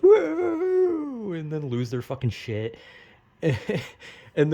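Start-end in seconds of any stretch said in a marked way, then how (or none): 0.0-1.3 very high, loud cheering whoop; the canned crowd going wild over the scene. Retold or imitated. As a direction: scream it like a hyped studio audience. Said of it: "And then the crowd goes".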